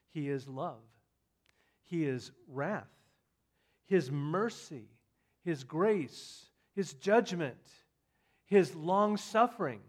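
ordinary talk

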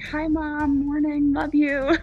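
slow, drawn-out fake-normal greeting; acting normal. Daughter hiding her moods from mom, acting normal. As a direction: force a sing-song everything's-fine hello. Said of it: "I'm trying to act normal".

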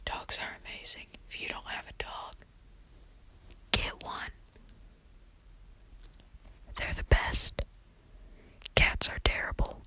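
whispered right into the microphone; close-mic whisper. Pretend. Vlogger teasing headphone users about dogs and cats, whispered close up. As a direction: whisper it, pushing harder toward the end. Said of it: "R I P headphone users".

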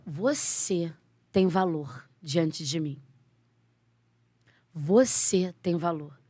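slow, solemn, gentle voice of God; God's voice. Retold or imitated. God speaking into her ear as she sits, slow and solemn. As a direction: let each word land slowly and tenderly. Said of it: "falou assim pra mim".

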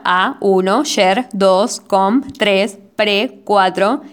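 each syllable alone, followed by its number; syllable count. Shown as a demonstration. Podcaster counting a verse's syllables for listeners, measured syllable count. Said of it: "el conteo sería".

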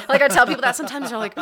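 talking through a big laugh, fast and loud. Real, not acted. Telling a friend about the phone location, laughing loudly. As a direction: burst out laughing mid-sentence.

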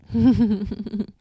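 short real laugh; amused laugh. Real, not acted. Host amused by Franny the extrovert, short laugh. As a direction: laugh briefly at your own aside.

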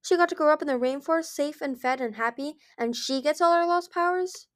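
high, indignant complaint; aggrieved cry. A narrator's reading. Mighty Claws resenting Moon's easy rainforest life, aggrieved. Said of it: "Mighty Claws cried".

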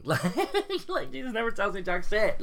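high laughing complaint. Real, not acted. Girl laughing about Jesus never talking to her.

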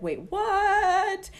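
high, stunned "wait, what"; a sudden jolt of surprise; shocked disbelief. Retold or imitated. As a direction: replay the moment of shock. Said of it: "I'm in shock".

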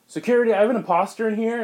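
high, loud call for security. Retold or imitated. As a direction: raise the alarm to the guards. Imposter calls security.

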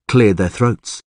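ordinary delivery

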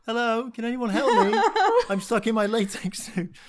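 high, loud, helpless call for help while laughing; pathetic plea for help. Retold or imitated. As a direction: play the stuck man pleading for help, loud and laughing.

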